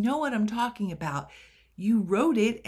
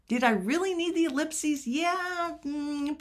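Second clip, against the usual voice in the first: mock self-doubt; 8 semitones higher than usual.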